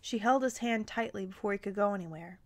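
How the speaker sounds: usual speaking voice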